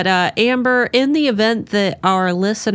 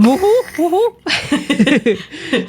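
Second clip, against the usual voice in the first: cheering; 10 semitones higher, much slower than usual. Real, not acted. Cheering the coffee.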